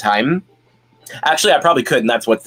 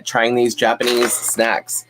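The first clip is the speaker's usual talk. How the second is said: ordinary delivery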